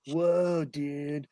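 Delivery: low, soft California surfer drawl; laid-back beach-dude accent, trailing off low. Acted. American demonstrates California's beach accent to British friends, beach accent. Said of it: "You got the beach accent".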